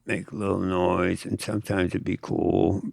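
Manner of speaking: ordinary delivery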